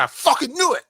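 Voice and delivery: high-pitched I-told-you-so exclamation; knew it all along. Retold or imitated. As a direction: blurt it out, vindicated. Retelling own reaction to news.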